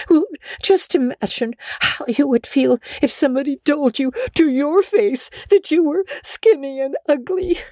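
put-on high girlish voice, pleading through tears; tearful pleading. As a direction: beg her guardian to understand. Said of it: "pleaded Anne tearfully".

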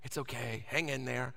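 low, soft comforting voice; gently calm a scared child; soft, low reassurance to a little kid; reassuring parent. Acted.

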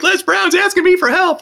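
very high-pitched, incredulous squeal; mock-shocked, astonished high voice; retold shock. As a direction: act out stunned disbelief, voice shooting up high. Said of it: "I was shocked".

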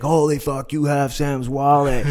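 high, drawn-out amazed outburst; excited bartender. Retold or imitated. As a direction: blurt it out, thrilled and high-pitched. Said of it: "he was so excited".

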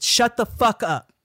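high, loud, slow yell; yelling at a classmate who won't stop talking, furious; shouted curse. Retold or imitated. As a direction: blow up at him with everything. Retold blow-up at classmate.

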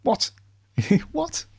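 high, startled double take with a laugh; baffled Doctor. Retold or imitated. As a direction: squeak out a bewildered what, then laugh. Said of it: "where the doctor's like".

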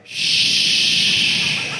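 drawn-out hissed shush; long shushing. Retold or imitated. Dismayed fan silences the singer, long shushing. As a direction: hush them with one long breath.

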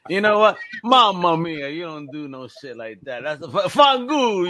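loud mock Italian mobster rant; Italian tough guy. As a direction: bluster like an angry Italian uncle. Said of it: "I know many Italians".

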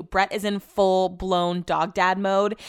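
usual speaking voice

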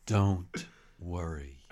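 slow, earnest poetry reading with long pauses; solemn poem read aloud, slowly. Shown as a demonstration. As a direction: recite it like a serious poem. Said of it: "I'm gonna do it in a poetry voice".

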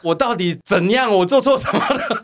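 high, loud laughing mock protest; indignant coworker. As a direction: ham up her outraged innocence. 模仿同事委屈喊冤. (高声夸张模仿喊冤)

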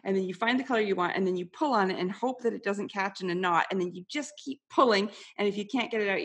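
ordinary delivery

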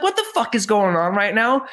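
totally lost and overwhelmed; baffled. Retold or imitated. Baffled self quoted.

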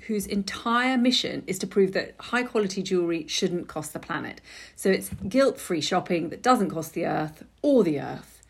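ordinary talk, nothing marked

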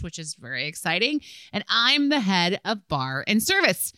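animated read-aloud with punched key words; lively letter read. Real, not acted. Host reads a server submitted story, animated read-aloud. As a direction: read it out brightly to the audience, swinging the pitch.

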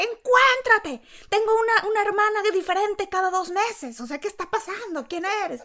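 high-pitched imitation of an exasperated sister; sister's scolding. Younger sister scolding her for changing boyfriends, high mock yell. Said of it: "Ese grito de tu hermana".

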